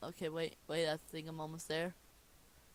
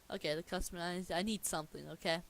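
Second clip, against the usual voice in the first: ordinary delivery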